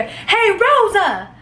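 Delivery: a high, slow mock call of her name. Guy bursting in, calling out to the girl by name, high and drawn out. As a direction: stretch the name out high like he's calling across the room.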